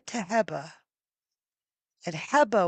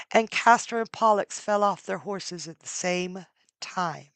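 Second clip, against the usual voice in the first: plain reading voice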